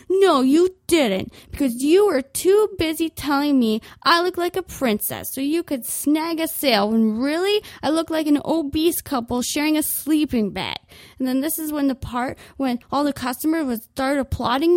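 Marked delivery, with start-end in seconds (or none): 0.1-10.8 mock indignant. Customer telling off the clerk, louder and indignant. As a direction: spit it back at her. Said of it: "then I'd say".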